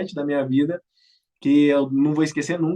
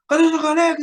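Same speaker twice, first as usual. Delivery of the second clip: higher, louder stern demand; firm demand; 10 semitones higher, 5 dB louder than usual. Retold or imitated. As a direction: bark it like a drill senior. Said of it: "tom de voz muito firme".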